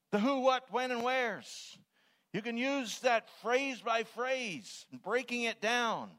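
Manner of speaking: high, loud, drawn-out exhortation; full-force preaching. Real, not acted. Preacher urging them to use these things, impassioned.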